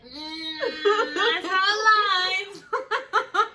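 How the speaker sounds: slow, high, stretched-out laughing whine; laughing worry. Retold or imitated. To the boyfriend in the back seat, a stretched laughing whine. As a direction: drag it out, cracking up while worried.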